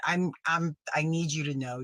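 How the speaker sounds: ordinary talk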